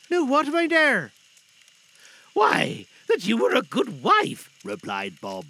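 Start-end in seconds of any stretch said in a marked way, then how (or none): 0.1-1.1 high, loud wifely question; puzzled wife. Acted. Wife asking Bob what he means, high and puzzled. 2.3-4.5 high, loud start on a fond reply; fond husband Bob. Acted. Bob praising his wife, fond and emphatic.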